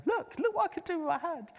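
a delighted man showing off his hand; the healed man excitedly shows the team his hand. Retold or imitated.

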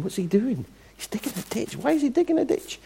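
high, hushed mock bafflement; small high-pitched crowd voice; puzzled onlookers. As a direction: squeak it like baffled bystanders murmuring. Puzzled onlookers voiced in retold story.

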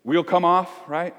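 ordinary talk

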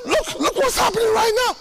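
high and quick, eager; mock excited prayer. Preacher retelling a prayer walk, excited high voice. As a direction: point God to it eagerly. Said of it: "I'll say".

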